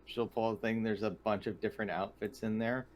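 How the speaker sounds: ordinary talk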